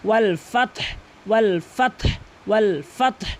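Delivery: ওয়াল ফাত with audible breathy h at the end; ha sounded. Shown as a demonstration. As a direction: model ফাত as "fat-h". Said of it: "উচ্চারণ আনতে হবে".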